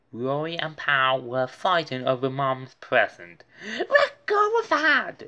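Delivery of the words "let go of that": high, louder yell; shout. A narrator's reading. Arthur shouting at the fighting dogs. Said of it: "shouted Arthur".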